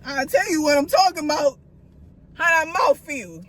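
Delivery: a hint of a mother warning a mouthy kid; mock scolding. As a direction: threaten the kids half in jest. Mom threatening kids who cuss.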